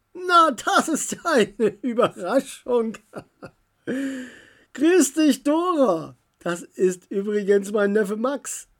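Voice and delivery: warmly surprised uncle greeting her; uncle's voice. A narrator's reading.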